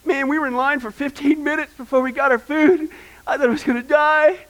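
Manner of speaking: hammed-up spoiled complaint about Carl's Junior; mock whiny complainer. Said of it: "go talk to those kind of people".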